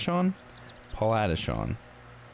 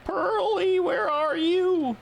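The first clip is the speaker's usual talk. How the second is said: high, sing-song call, last word held long; 6 dB louder, much slower than usual. Acted. Searching the village for Pearly, high drawn-out call. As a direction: call out for someone out of sight. Calling out for a character.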